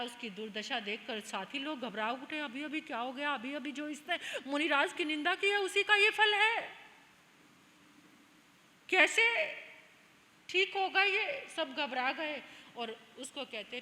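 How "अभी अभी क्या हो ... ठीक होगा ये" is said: horrified companions, loud and high; acted alarm. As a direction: cry out in panic, building higher and much louder. Companions panic at prince's affliction. (घबराहट भरी ऊँची पुकार)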